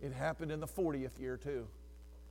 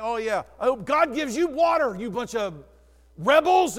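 mock angry shout, louder and higher; angry yell; 6 semitones higher, 13 dB louder than usual. Moses yelling at the complaining Israelites, hammed-up angry shout. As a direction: bark it like a fed-up Moses. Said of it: "He gets so angry with them".

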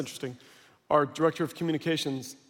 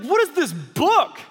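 indignant question; 9 semitones higher, 4 dB louder, slower than usual. Retold or imitated. Demanding an answer about the book.

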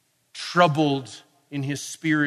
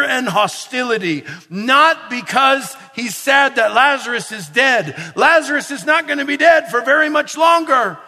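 loud, higher, rising fervent sermon delivery; full-force preaching; 5 semitones higher, 6 dB louder than usual. Acted. Preacher to his congregation about Jesus' anger, full-force preaching. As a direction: preach it hard, building to a climax.

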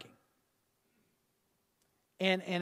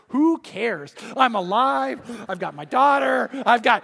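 louder, jubilant burst; elated outburst, much louder; joyful relief; 4 semitones higher, 9 dB louder, slower than usual. Retold or imitated. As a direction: shout out the joy of being alive. Joy after near-death escape.

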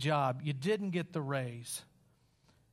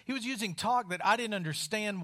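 ordinary talk, nothing marked

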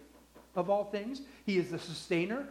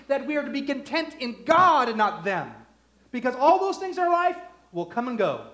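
louder, higher, driving the point home; full-force preaching; 7 semitones higher, 7 dB louder than usual. Acted. Pastor to congregation, preaching at full force.

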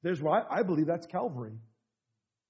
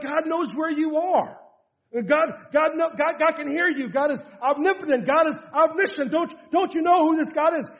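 fervent rebuke; 10 semitones higher, 7 dB louder than usual. Retold or imitated. Preacher rebukes the hesitant prayer.

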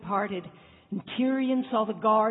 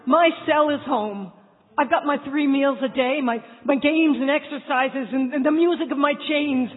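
frightened shouting; 4 semitones higher, 7 dB louder than usual. Acted.

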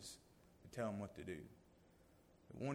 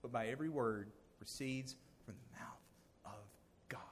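whispered scripture; 3 semitones lower, 6 dB quieter than usual. Retold or imitated. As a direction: breathe the true verse in a whisper.

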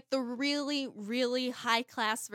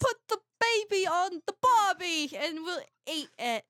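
high, louder hammed-up Australian; mock Australian accent; 7 semitones higher, 5 dB louder than usual. Co-host joining the joke, mock Australian accent. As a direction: ham up a bad Australian accent. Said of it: "that's how Australians pronounce it".

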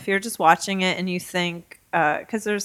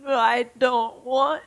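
backwards talk; 4 semitones higher, much slower than usual. Pretend. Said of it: "[name], try it".

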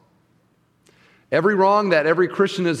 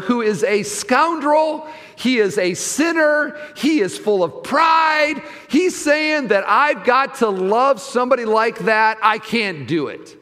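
indignant shout; 7 semitones higher than usual. Retold or imitated. Preacher voicing a listener's outrage at a sinful relative, higher indignant shout.

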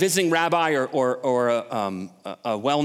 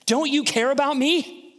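high, whiny, accusing complaint; Martha in Jesus' face; 11 semitones higher, 4 dB louder than usual. Acted. Martha confronts Jesus about her stress, high whiny accusation. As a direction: plead like a wronged, stressed sister. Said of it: "Martha gets all up in Jesus' face".

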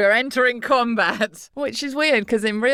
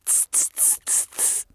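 hissing noise; 10 dB quieter than usual. Pretend. Imitated noise mid-recording.

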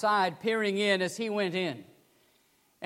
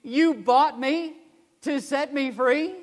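louder, higher, slow tearful question; tearful cry; 6 semitones higher, 4 dB louder, slower than usual. Retold or imitated. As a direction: repeat it in stunned tears. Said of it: "kept repeating".

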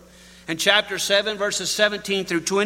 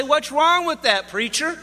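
higher, challenging listener voice; preacher voicing a doubter in the pews, higher and challenging; questioning listener; 8 semitones higher than usual. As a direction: voice a skeptical congregant, pitched up. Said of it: "And you say".

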